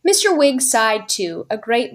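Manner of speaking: ordinary delivery, nothing marked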